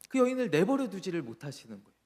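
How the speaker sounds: usual speaking voice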